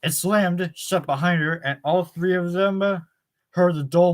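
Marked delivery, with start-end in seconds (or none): none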